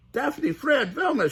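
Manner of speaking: high Shaggy calling friends; high cartoon teen calling names. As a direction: call out with relief. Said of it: "Shaggy waved to them".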